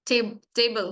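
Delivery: first part टेब alone, then whole table; syllable demonstration. Shown as a demonstration. Teacher giving a syllable example, टेब alone before table. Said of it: "एक से ज्यादा syllable".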